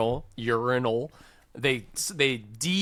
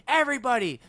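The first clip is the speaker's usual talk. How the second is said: higher-pitched flight attendant giving orders; 6 semitones higher than usual. Retold or imitated. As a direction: imitate a cabin announcement, drilling passengers in short phrases. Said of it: "the flight attendant is like".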